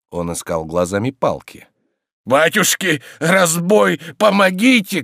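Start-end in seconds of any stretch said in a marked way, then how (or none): 2.2-5.0 high, loud alarm, climbing; crying for help. Acted. Tarantyev flees the threat, crying for help. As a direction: yell for rescue from robbers. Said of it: "кричал Тарантьев".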